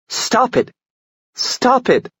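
high, desperate cry to stop the fight; frantic shout. Acted. Tessa screaming at the brawling men to stop, frantic shout.

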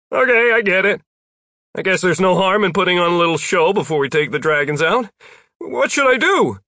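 high, projected character voice; Jeff calling down. A narrator's reading. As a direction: call down your agreement from overhead. Narrator voices Jeff calling from the air.

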